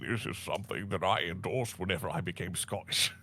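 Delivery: put-on light Scottish accent, still in character; Connery disowning his dragon role in a Scottish accent; Sean Connery impression. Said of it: "Sean Connery as the dragon".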